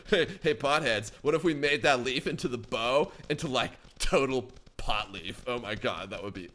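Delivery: laid-back stoner voice, dropping low; mock pothead, softening at the end; weird Valley guy. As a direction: put on a dopey Valley guy. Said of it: "why am I talking, like, with a weird Valley guy".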